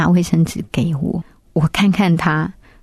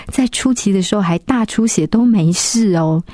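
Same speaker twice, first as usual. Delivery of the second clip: normal talking voice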